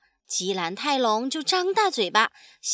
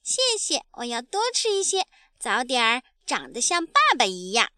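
put-on eager baby dinosaur; faster than usual. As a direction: thank daddy like a keen little kid. (懂事又急切的小恐龙童声)

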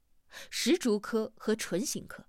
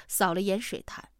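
ordinary delivery

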